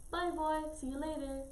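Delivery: a small boy's high goodbye, retold; imitated little brother. Little brother saying bye to house.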